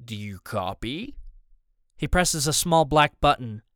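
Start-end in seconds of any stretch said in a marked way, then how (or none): 0.0-1.2 low, soft, slow radio voice; slow, deliberate captain on the radio. A narrator's reading. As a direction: draw the question out slowly in a put-on accent. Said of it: "strong and slow".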